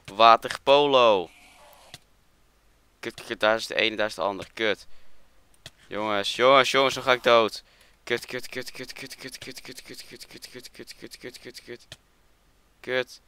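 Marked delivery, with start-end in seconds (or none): 0.1-1.3 loud, drawn-out shout; playful battle cry. Real, not acted. Jumping into water in game. 5.9-7.6 loud, raised panicky cry; panicked. Real, not acted. About to die in game. 8.0-13.2 high, rapid cursing dropping very quiet; nervous panic. Real, not acted. Fleeing near death, cursing repeatedly.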